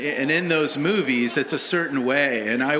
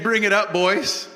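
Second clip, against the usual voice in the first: coach calling in; 6 semitones higher, 4 dB louder than usual. Retold or imitated. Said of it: "This is Jesus saying".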